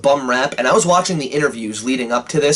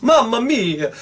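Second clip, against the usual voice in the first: high hammed-up Italian "Mamma mia!"; mock Italian; 9 semitones higher, slower than usual. Reviewer hamming up an Italian cry over great action. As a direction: throw out a cartoon Italian exclamation, pitched high. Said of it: "I went full-blooded Italian there".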